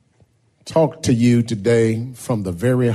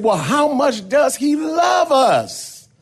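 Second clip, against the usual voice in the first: much higher, shouted; children shouting. Acted. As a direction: kids yelling up at the teacher. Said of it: "They said".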